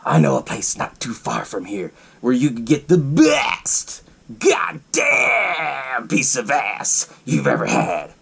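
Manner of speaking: slow, drawn-out acted sales pitch; intense pitch man. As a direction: stretch the words out with heavy relish. Retells sleazy stranger pitching a brothel.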